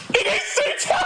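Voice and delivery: ecstatic shout. Real, not acted. Streamer celebrating a late goal, ecstatic shout. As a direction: scream it out as the goal goes in. Goal scored in the watched match.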